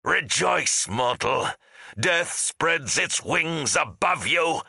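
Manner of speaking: high, drawn-out villain's cry; menacing proclamation. A narrator's reading. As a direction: proclaim doom over a fallen foe, high and slow. Villain proclaims doom over fallen foe.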